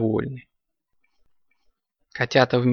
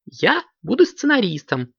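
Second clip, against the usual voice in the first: put-on high girl-kitten voice; high, childlike exclamation; eager exclamation; 10 semitones higher than usual. A narrator's reading. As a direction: claim the role in a high, keen voice. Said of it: "первой воскликнула Карамелька".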